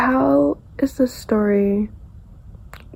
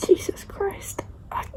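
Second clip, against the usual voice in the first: stunned whisper; 9 semitones higher, 6 dB quieter, slower than usual. Real, not acted. Reacting to sad reading.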